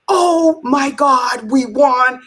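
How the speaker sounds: slow, high, stunned joy; retold elation. COO opening the award email, slow high elation. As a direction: let the disbelief stretch out slowly.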